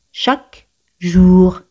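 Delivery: "Chaque ... jour", slow, the words apart; slow demonstration. Shown as a demonstration. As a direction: draw out "jour" after a gap. Teacher models phrase slowly, then joined.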